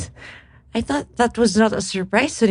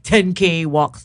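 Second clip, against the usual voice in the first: normal talking voice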